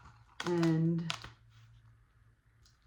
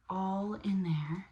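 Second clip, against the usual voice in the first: plain reading voice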